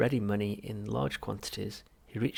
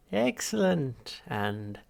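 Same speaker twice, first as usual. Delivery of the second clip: much higher, slow delighted shrink; Halfrunt voice; 5 semitones higher, 5 dB louder, much slower than usual. Acted. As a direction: savour it like a pleased therapist. Psychiatrist voiced.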